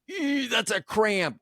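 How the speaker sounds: high, slow squeak of pain; mock cramp cry. Husband mimics his own cramp during intimacy, mock squeal.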